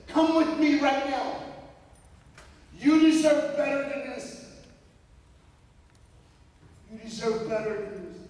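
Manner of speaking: high and loud, commanding, easing off at the end; firm rescue. Retold or imitated. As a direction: take his hand and pull him out of there. Girl rescues Vito, defiant.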